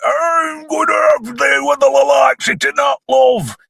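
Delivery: high-pitched shouted Yorkshire impression; Yorkshire accent. Reviewer voicing a randy villain, high Yorkshire holler. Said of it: "in his Yorkshire accent".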